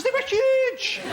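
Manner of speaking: very high-pitched squeal on the words; silly high voice. Real, not acted. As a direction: shoot up into a comic high voice. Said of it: "said it in that voice".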